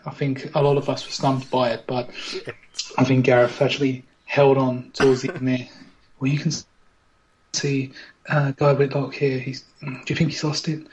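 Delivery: low hushed live commentary; game commentator. Acted. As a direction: talk him up like a TV commentator. Mock commentary on contestants.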